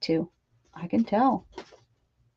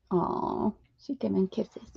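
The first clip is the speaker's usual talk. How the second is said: soft whisper; 7 dB quieter, slower than usual. Real, not acted. Cooing at the pup kissing her, a soft whisper. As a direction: breathe it softly, just air.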